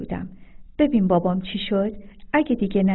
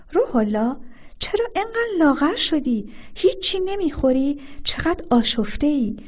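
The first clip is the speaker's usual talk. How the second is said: shocked, much higher worried questions; alarmed at how thin he looks; wife shocked at her husband's haggard look; 6 semitones higher than usual. Acted. Wife shocked at his state.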